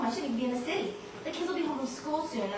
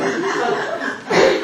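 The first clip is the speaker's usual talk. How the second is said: nervous giggle; 7 semitones higher, 12 dB louder than usual. Acted. Nervous arrival laugh.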